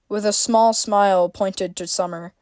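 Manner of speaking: usual speaking voice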